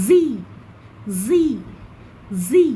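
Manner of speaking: North American "জি" for Z, spaced drill; American zee. Shown as a demonstration. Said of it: "নর্থ আমেরিকানে বলবো".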